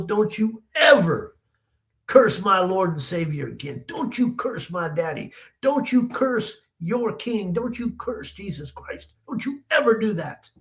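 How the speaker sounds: high, drawn-out rebuke; stern scolding. Retold or imitated. Grandmother rebuking grandson for cursing her Lord, stern.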